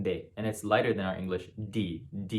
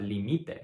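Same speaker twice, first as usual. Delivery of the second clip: límite stressed as li-MI-te; middle-syllable stress. Shown as a demonstration. Teacher giving the subjunctive form, límite as li-MI-te. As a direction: put the weight on MI in límite. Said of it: "which is the subjunctive third person singular".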